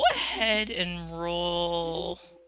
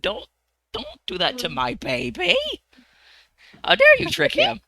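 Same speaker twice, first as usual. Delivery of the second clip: stern nun; 3 semitones higher, 4 dB louder than usual. Acted. Wizard scolding over stolen pearl.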